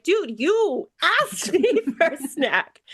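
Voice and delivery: stretching the words out, amused and fed up; exasperated laugh. Retold or imitated. Mom retelling exasperated reply.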